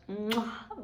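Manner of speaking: smacking sign-off kiss; blown kiss. Real, not acted. Vlogger sending love to viewers at sign-off, blown kiss.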